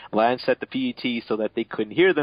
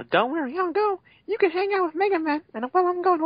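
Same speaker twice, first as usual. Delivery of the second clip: squeaky high falsetto; silly falsetto voice. Acted. Narrator voicing the character in a squeaky falsetto, joking it's his male voice. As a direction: pitch way up for comic effect.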